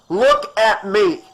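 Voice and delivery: loud, slow, firm shout; doctor shouting to snap a woman out of panic. Acted. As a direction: make her look at you. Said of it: "he shouted".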